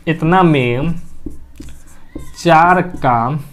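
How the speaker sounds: very slow, one piece at a time, long pauses; slow dictation. Real, not acted. Teacher dictating an example for students to copy, slow dictation. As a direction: stretch each word out at writing speed.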